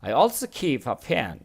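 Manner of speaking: each word read out slowly and clearly; slow model reading. Shown as a demonstration. Teacher reading the sentence slowly to students. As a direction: read it slowly for the class to follow. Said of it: "teacher reads model sentence slowly".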